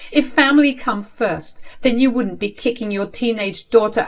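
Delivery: high, loud challenge; indignant retort. Acted. Teenage daughter challenging father, indignant retort. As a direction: hurl the principle back at them.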